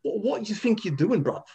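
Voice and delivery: put-on high, aggressive London bruv accent; English accent. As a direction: challenge a mate like a London lad. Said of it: "And then you go".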